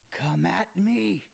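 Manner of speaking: half-strength warrior's yell, words drawn out; mock battle scream. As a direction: play a slow, restrained battle cry at the foe. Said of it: "she just screams at the top of her lungs".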